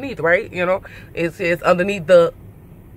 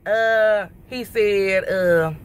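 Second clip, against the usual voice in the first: normal talking voice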